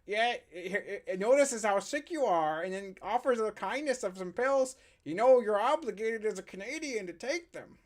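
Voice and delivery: hammed-up, eager Canadian voice; attempted Canadian accent. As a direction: put on an exaggerated Canadian accent. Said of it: "trying to do Canadian".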